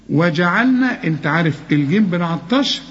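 usual speaking voice

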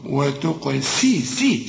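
"وتقسي" slowly, thin s and long "ee"; seen with kasra. Shown as a demonstration. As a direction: isolate a clear "سي". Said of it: "لا، دي سين ومكسورة".

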